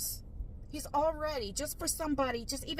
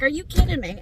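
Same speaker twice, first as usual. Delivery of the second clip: mock outrage; 4 semitones higher, 14 dB louder than usual. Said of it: "And I'm like".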